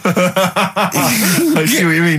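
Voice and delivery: laughing agreement. Real, not acted. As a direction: say it while laughing. Laughing at the burger joke.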